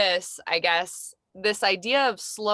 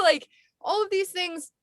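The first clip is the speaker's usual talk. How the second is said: ordinary talk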